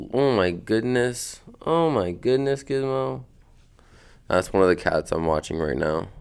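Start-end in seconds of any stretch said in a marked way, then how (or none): 0.1-3.2 high, slow cooing at a cat; pet talk. Real, not acted. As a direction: coo at the pet. Fussing over the cat.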